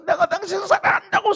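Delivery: high, loud yell of love; shouted at wife. Retold or imitated. Husband shouting at his wife in a quarrel, loud yell. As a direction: yell it out in frustration. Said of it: "소리를 질렀는데".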